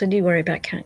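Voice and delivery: ordinary talk, nothing marked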